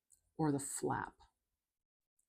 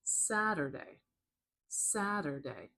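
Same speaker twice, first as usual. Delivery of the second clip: "Saturday" with SAT higher and longer; slow "Saturday", first syllable stretched and raised; stress on first syllable; slower than usual. Shown as a demonstration. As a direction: lean on the start of "Saturday", then fall. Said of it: "higher pitched and a little bit louder and longer at the beginning".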